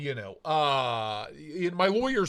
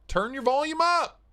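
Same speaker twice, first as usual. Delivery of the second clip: high, raised-voice retort; exasperated call; 11 semitones higher, 4 dB louder than usual. Real, not acted. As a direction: call it out at the complainer. Retorts to complaint of low volume.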